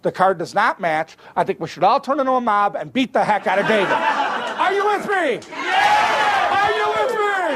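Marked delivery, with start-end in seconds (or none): 3.9-7.5 high, slow, drawn-out shout; hammed-up call to a pretend mob; mock rally shout. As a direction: stir up the crowd like a hammed-up mob leader.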